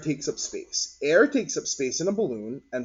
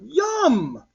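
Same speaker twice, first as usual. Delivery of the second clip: very high, loud yum; eager yum; 13 semitones higher, 6 dB louder than usual. Acted. Martin imagining candy, high eager yum. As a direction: savour it like a hungry kid.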